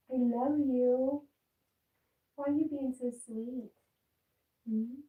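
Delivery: slow high cooing love to the dog; gushing pet voice. Real, not acted. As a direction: melt over the sweet dog.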